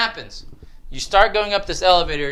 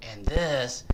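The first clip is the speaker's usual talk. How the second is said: ordinary talk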